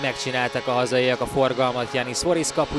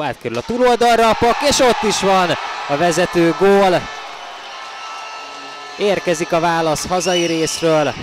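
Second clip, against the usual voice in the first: excited goal call; 7 semitones higher, 8 dB louder than usual. Real, not acted. Goal call in hockey highlights.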